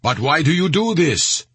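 high, insistent questioning; persisting. A narrator's reading. Saudi soldier persisting with the angry mob, insistent. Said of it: "the Saudi persisted".

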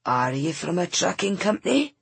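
put-on a grumpy old man doubting her; gruff old Scots man. Old van seller doubts the young woman, gruffly. Wooly's held voice.

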